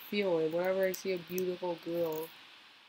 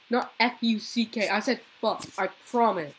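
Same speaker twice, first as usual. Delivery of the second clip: normal talking voice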